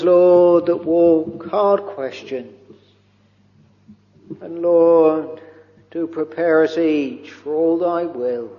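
normal talking voice

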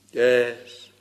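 normal talking voice

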